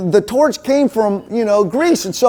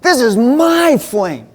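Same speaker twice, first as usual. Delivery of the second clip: possessive refusal; 3 semitones higher, much slower than usual. Pretend.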